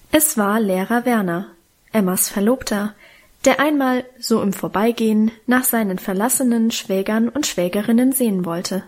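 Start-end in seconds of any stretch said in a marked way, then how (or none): none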